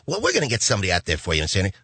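fast, kindly caller promise; quickly reassuring phone lady; sweet reassurance. Retold or imitated. Shirley promises to send someone, fast and sweet. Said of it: "she was so sweet".

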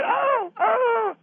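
high, shrill-free cartoon squeal of alarm; mock frightened lady wail; mock panicked wail. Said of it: "I know you didn't sound like that".

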